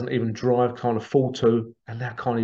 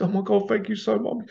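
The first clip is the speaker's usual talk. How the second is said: much higher, gushing thank-you; retold delighted gasp of thanks; overjoyed thanks; 8 semitones higher than usual. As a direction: imitate a man overwhelmed with gratitude. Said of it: "He says".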